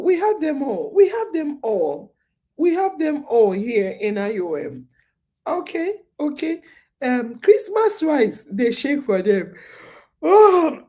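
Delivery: ordinary delivery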